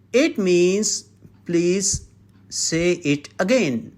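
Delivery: ordinary talk, nothing marked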